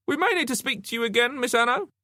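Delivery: high-pitched detective interrogating; questioning detective. A narrator's reading. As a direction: press the witness in a high voice.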